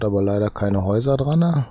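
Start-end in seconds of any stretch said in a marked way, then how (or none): none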